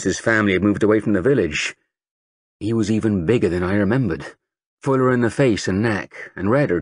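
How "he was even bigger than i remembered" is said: ordinary delivery, nothing marked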